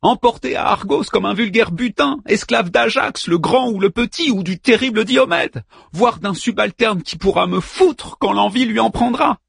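wife screaming her fate at Hector; screamed. Acted. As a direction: yell it out, high and furious. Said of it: "hurla Andromaque".

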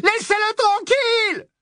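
high frantic scream, pushing harder; rising panicked scream; screaming plea. A narrator's reading. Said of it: "Hermione poussa un hurlement".

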